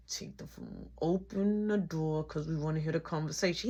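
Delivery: hushed, low secret urging; exaggerated mouthing. Sister to brother while the phone is muted, hushed mouthing. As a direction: half-whisper it, mouthing the words. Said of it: "doing your mouth like that".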